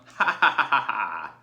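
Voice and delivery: loud bleating laugh; bleating lamb laugh. Acted. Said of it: "bleated a big lamb".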